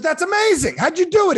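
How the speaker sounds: loud, high-pitched astonished outburst; excited amazement. Acted. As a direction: gush like an amazed customer. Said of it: "Now he's excited".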